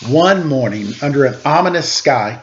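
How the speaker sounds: storybook read-aloud, gently paced; story narration. A narrator's reading. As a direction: read it like a picture book to children. Reads a picture book aloud.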